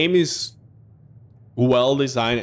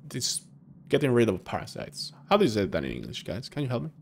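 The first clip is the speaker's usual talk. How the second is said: normal talking voice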